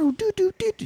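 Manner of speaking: high sung doo doo organ riff; organ imitation. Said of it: "Shitty eighties organ".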